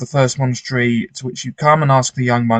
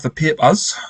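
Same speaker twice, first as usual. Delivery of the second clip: Geordie 'papers' with a pinched first vowel, pee-pus; Geordie accent; 5 semitones higher than usual. Acted. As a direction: say papers the Geordie way. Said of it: "I said it in a Geordie accent there".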